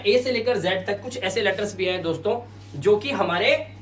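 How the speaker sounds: ordinary talk, nothing marked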